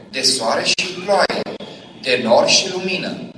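impassioned preacher building; fervent preaching. Real, not acted. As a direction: drive the point home to the crowd. Preaching climax.

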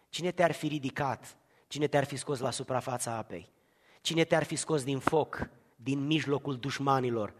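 low, tender pulpit voice; hushed preaching. Acted. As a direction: draw the listeners in close. Quiet intimate preaching.

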